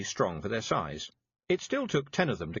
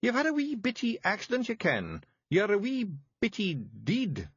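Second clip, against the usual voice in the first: high, thick Scots pixie voice; small tough Scots fairy breaking bad news; Scottish Feegle voice; 11 semitones higher than usual. A narrator's reading. Narrator voices Feegle Rob.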